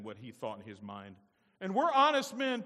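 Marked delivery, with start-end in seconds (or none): 1.6-2.6 high, loud mock protest; exaggerated sarcastic imitation; mocking mimicry. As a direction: parrot their claim in a raised, scornful voice. Joseph mimics brothers' claim in his mind.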